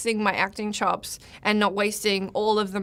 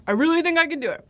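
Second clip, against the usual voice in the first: higher, louder mocking echo; 7 semitones higher, 4 dB louder, faster than usual. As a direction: parrot it back, loud and high. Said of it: "I like all the priming".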